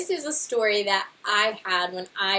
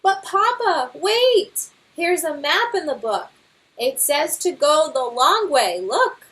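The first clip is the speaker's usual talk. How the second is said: high, louder calling out in worry; alarmed cub; 8 semitones higher, 6 dB louder than usual. Acted. Cub calling out as his father's knot fails, alarmed. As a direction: shout as Dad falls.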